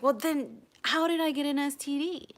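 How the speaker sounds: angry retort. Retold or imitated. Acted quarrel with ex.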